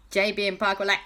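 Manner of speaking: plain reading voice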